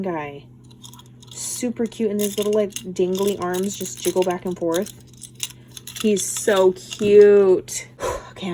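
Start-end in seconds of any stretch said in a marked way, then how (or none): 6.0-8.5 breathless, gasping between words; winded, air running out; out of breath. Real, not acted. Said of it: "I'm out of breath".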